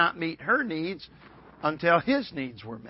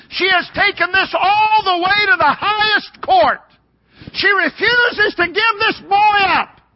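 very high, shouted proclamation; sustained pulpit shout at peak intensity; full-force preaching; 16 dB louder, slower than usual. Real, not acted. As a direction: cry it out to the whole congregation.

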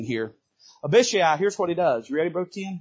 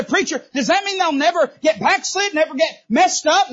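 mock questioner; 11 semitones higher, 6 dB louder than usual.

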